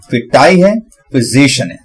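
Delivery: usual speaking voice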